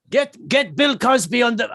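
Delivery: badly faked Russian accent; failed Russian accent. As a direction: attempt a Russian accent, then give up. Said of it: "I can't do the accent".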